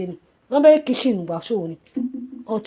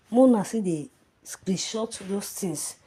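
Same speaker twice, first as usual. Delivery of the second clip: normal talking voice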